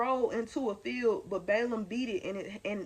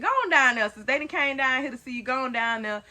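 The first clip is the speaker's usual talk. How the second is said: loud insistent God, louder all through; God commanding loudly; 3 semitones higher, 9 dB louder than usual. Retold or imitated. Mom voicing God ordering the angel down the road, loud insistent command. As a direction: boom the order like an impatient God. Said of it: "He said, he said".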